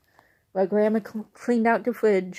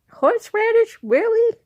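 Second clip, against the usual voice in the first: high, loud disbelieving squawk; incredulous; 8 dB louder than usual. Real, not acted. Narrator squawking in disbelief at mom's horseradish. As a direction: react as if it's absurd.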